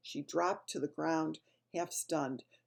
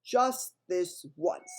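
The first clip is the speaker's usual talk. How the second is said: slow, louder reluctant giant; grudging giant; 6 dB louder, much slower than usual. A narrator's reading. Bloodbottler reluctantly agrees to taste, slow and grudging. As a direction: give in grudgingly. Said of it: "said the blood bottler".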